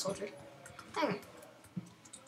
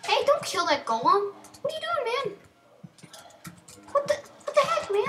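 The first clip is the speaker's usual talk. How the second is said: high, loud panicked call; real alarm, voice jumping high; alarmed yelling; 11 semitones higher, 4 dB louder than usual. Real, not acted. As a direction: cry out in alarm at the villager. Villager attacks golem, yelling.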